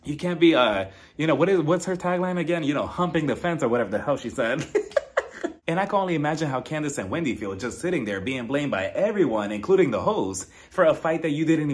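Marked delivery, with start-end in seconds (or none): 4.7-5.6 high, breathy giggle; laugh. Real, not acted. Host laughs at the misremembered tagline, high giggle. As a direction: giggle in a high, airy burst.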